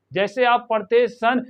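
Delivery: ordinary delivery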